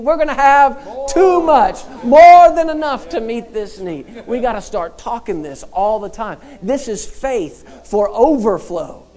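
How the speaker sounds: loud, high preaching voice; full-force preaching. Acted. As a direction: preach it out to the whole church.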